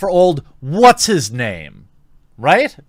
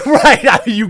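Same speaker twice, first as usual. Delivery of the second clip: laughing; 8 semitones higher, 6 dB louder than usual. Real, not acted. Laughs while asking.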